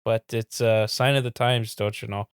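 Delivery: put-on resigned Minnesotan, thick accent; deep Minnesota accent. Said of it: "like, a deep Minnesota accent".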